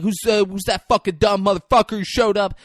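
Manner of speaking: loud, high mock fury; hammed-up retold outburst; guns blazing. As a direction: imitate someone storming in angry. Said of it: "guns blazing".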